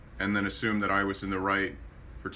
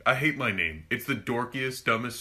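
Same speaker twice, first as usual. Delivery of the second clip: usual speaking voice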